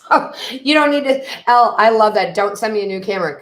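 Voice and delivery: loud, high burst of gratitude; delighted protest. Real, not acted. Reader waving off Elle's offer of a camera, delighted protest. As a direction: cut in loudly, touched and excited.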